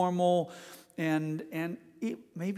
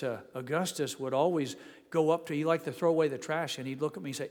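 ordinary delivery

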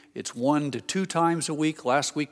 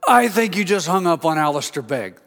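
higher, loud mock accusation; loud outburst; 4 semitones higher, 6 dB louder than usual. Pastor to secretary about the lost call, loud and aghast. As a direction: blurt it out loud in comic alarm. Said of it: "out loud I said".

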